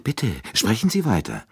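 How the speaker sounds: detective urging the colonel to continue. Acted. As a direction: gently press him to go on. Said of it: "drängte Poirot".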